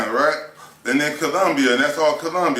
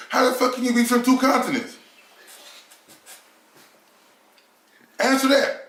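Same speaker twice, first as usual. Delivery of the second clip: incredulous; 8 semitones higher than usual. Real, not acted. Incredulous rhetorical challenge.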